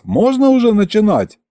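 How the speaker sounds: high, called-out question; shout from the crowd. A narrator's reading. First heckler asks the tsar to begin, shouting. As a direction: call it out over heads. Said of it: "крикнул кто-то из толпы".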